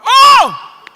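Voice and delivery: loud, high outraged yell; hammed-up shout of disbelief; exploding in mock outrage.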